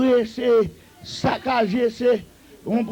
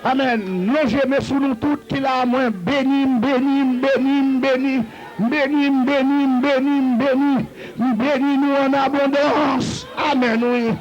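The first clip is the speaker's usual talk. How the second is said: ringing, repeated blessings with long-held cries; rally the church into praise and applause; fervent preaching; 3 semitones higher than usual. Real, not acted. Preaches blessings at full force.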